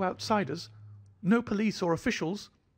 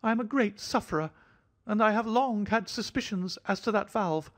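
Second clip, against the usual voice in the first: light, offhand, much higher thin voice; airy; 11 semitones higher than usual. A narrator's reading. Host airily apologizing to Miss Morstan in a high thin voice. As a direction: toss it off as if nothing mattered. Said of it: "he remarked airily".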